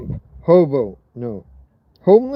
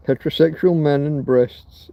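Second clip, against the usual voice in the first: normal talking voice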